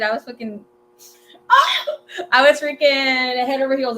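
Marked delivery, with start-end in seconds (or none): 1.5-2.3 sudden high squealing laugh; a high squeal of laughter; burst of laughter. Real, not acted. Laughing at own crush confession. 2.3-4.0 gushing. Real, not acted. Confessing she was smitten.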